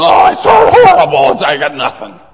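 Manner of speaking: high, loud hammed-up wail of despair; mock wail. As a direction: wail it like the world is ending. Disciples wailing over no bread.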